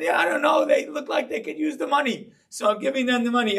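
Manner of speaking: put-on a boy proud of his plan; excited little kid. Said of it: "I said".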